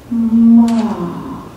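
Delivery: "ma" with a long hummed m; drawn-out syllable. Shown as a demonstration. Author demonstrates the syllable, "ma" drawn out from a hum. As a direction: stretch "ma", lingering on the m before opening the vowel. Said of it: "And the syllable".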